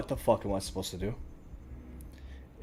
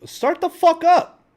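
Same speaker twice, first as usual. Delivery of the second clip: much higher, louder and slow drawn-out yell; real enraged shout at the computer; furious yell; 10 dB louder, slower than usual. Real, not acted. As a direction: scream it at the frozen game. Yelling at crashed game.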